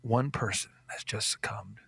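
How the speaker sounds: hushed, whispered grim news; fake sentimental anchor. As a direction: drop to a mock solemn whisper. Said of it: "The news guy goes".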